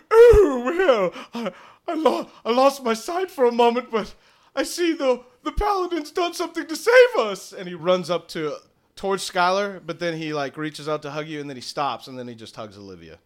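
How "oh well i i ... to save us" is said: high, wavering character voice; flustered, much higher and louder, stumbling over words; Smithy. Acted. Smithy telling the party they are saved, high flustered voice. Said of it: "Smithy walks up and he says".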